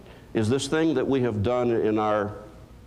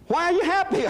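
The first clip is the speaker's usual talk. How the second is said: much higher, louder disbelieving squeak; lightly voiced baffled question, pitched way up; mock incredulous; 3 dB louder than usual. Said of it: "retold exchange with doctor".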